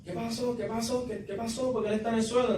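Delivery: high, alarmed cries of what happened; a partner finding him on the floor, calling out in alarm; panicked yelling. Retold or imitated. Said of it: "empieza a gritar".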